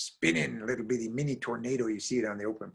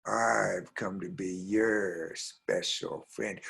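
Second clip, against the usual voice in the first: very slow, drawn-out, lower voice; mysterious stranger; 3 semitones lower, much slower than usual. Acted. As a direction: lure her with long, slow words. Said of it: "And the person said".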